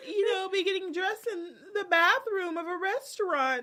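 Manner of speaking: high, crying-voiced mock; mock tearful bride. Bride dressing in restaurant bathroom, mock tearful. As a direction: whimper it out, pitched high. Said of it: "that's when it all hit her, like".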